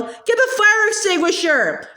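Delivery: high, urgent call for help; urgent yell. A narrator's reading. Boy yells to his brother in the burning kitchen, high urgent call. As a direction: push the order out in a panic. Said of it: "Sandy yelled".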